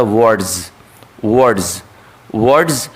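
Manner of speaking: वर्ड्स ending in a buzzing z; what's said as a buzzing z. Shown as a demonstration. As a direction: end वर्ड्स on a voiced z sound. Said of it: "S जो है जा sound देता है".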